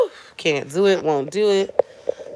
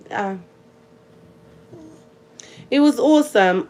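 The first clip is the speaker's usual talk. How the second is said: put-on British accent, slightly raised pitch; 3 semitones higher than usual. Shown as a demonstration. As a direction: play at being British over tea. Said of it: "Should I do my British accent?".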